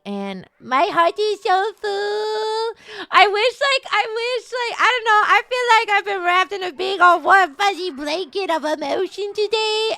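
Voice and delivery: high, gushing squeal of gratitude; high-pitched, emotional outpouring; overcome with feeling. Real, not acted. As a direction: gush as if your heart is overflowing. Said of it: "I'm very much in my feelings".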